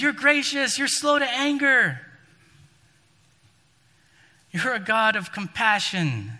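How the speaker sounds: exasperated Jonah. Acted. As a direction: throw God's own virtues back at him, fed up. Jonah complaining angrily to God.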